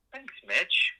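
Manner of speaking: high giggly thanks; laughing thanks. Acted. Assistant praised by Mitch, high flattered giggle.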